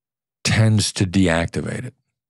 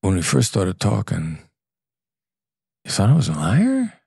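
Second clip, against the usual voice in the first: curious and kind. Shown as a demonstration. To a client who once doubted him, gentle and curious. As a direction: ask it lightly, with no blame. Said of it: "And I could say".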